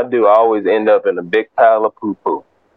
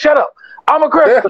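high-pitched, comic insistence; imitated squeaky protest; mock indignant retort. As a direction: play the exasperated new convert, pitched way up. Saul retorts to the doubters.

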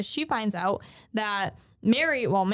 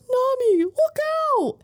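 high, loud shout of alarm; warning cry; 7 dB louder than usual. Retold or imitated. Zoro warning Nami of a flying blade, urgent shout. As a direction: yell a warning across a distance, drawn out.

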